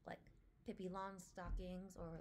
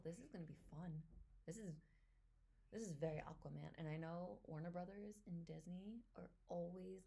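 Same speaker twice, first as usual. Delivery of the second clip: ordinary talk, nothing marked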